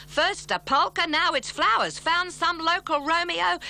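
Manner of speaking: indignant yell. Acted. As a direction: throw the complaint up like a fuming bandleader.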